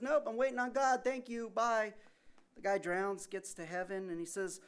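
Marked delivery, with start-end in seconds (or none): 0.0-1.9 much higher and louder, breezy brush-off; man on the roof cheerfully sending the helicopter away; cheery refusal. Retold or imitated. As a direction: wave them off brightly.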